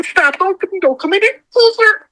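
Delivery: high-pitched, halting mock plea; retold alarmed warning. Woman with a ghost seven steps away, high retold alarm.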